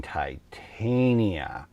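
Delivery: "Titania" as tie-TAIN-ya; "Titania" with long i and long a; common pronunciation. Shown as a demonstration. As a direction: spell out "Titania" by ear, TIE then TAIN. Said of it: "a lot of people here saying".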